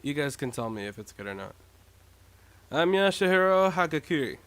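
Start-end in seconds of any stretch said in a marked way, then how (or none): 2.7-4.4 louder laid-back stoner; loud, lazy stoner voice. Acted. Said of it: "somewhat of a stoner voice".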